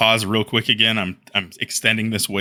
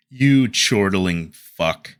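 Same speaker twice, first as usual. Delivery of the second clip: usual speaking voice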